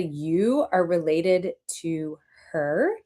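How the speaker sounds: very slow, drawn-out, incredulous question; disbelieving. A narrator's reading. Co-star doubting the two are sisters, pointedly incredulous. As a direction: spell out the disbelief word by word. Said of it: "in a way that said".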